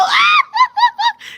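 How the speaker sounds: high, loud cackle of laughter; shrieking laughter. Real, not acted. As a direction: burst out in a piercing laugh. Shrieking at own pun.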